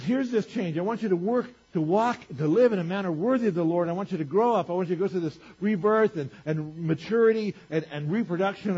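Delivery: full-force preaching. Acted. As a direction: preach it hard to the whole room.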